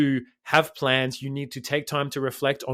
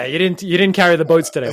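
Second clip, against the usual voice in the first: a much louder, harsh drill-sergeant bark at yourself; David Goggins; 3 semitones higher, 8 dB louder than usual. Retold or imitated. Mocking a brutal inner voice, loud drill-sergeant bark. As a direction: berate yourself like a tough-love ultramarathoner. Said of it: "Maybe David Goggins talks like that".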